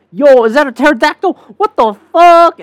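high, loud, thrilled disbelief; hammed-up astonishment at a wild sight; amazed outburst. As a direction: exclaim it, stretching out the swearing. Acting out amazement at dinosaurs.

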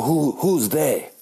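put-on grandma's drawn-out German accent; slow, puzzled question in a German accent. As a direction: imitate a German grandmother, stretching the words. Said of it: "Remember, she has a German accent".

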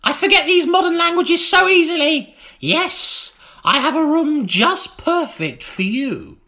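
crank it up to a shrill crone; old woman voice. Acted. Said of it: "she began".

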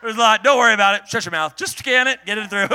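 loud, laughing demand to the cashier; wife and I at the checkout, loudly insisting; pushy checkout demand. Retold or imitated. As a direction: rush the cashier, half laughing. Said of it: "We're like".

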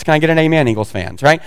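normal talking voice

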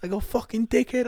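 high-pitched mock-furious yell; hammed-up road-rage voice; mad lady driver. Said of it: "this one lady, bro, got so mad".

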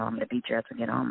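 low, quiet, fast threat; retold menace, hurried and low; imitated threat. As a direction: mimic a threat said under his breath. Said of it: "threatening me".

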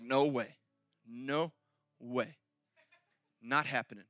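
low, quiet, spaced-out refusal; dead-certain low dismissal; firm denial. Retold or imitated. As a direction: pause between words, utterly sure. Peter flatly refusing the prediction.

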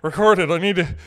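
loud, urgent demands. Retold or imitated. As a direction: push it out like an obsessed rocker. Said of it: "be like".

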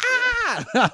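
sudden high mock shriek of pain; imitated scream. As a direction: yelp it like the villain getting floored. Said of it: "a scream from Missus Monday".

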